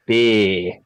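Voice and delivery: slow, drawn-out Australian beer; Australian accent demo. Shown as a demonstration. Asked how to do it, he demos a slow Australian beer. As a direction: stretch the word beer like an Aussie. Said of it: "How do you do an Australian accent?".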